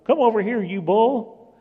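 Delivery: high, loud call to a bull; bullfighter's taunt. Acted. Preacher acting a bullfighter, taunting call to the bull. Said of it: "What does he say to the bull?".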